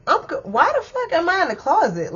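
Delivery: waking up baffled in a closet, voice shooting up; shocked disbelief. Retold or imitated. Retelling waking up in the closet, shocked high disbelief. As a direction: pitch jumps high in shocked confusion.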